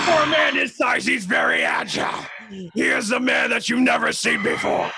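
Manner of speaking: shouted nineties wrestling manager promo; wrestling manager hype. Acted. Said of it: "was that Macho Man or was that Hulk".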